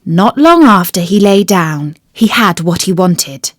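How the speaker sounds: usual speaking voice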